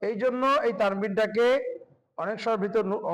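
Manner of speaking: ordinary talk, nothing marked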